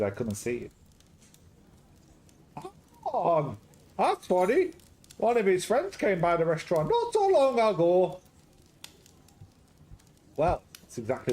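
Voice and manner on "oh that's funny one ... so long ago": high, loud hammed-up Greek-Scottish accent; a jolly Greek cook with a Scottish lilt, up high and loud; garbled Greek-Scottish accent. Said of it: "Garbled Greek Scottish".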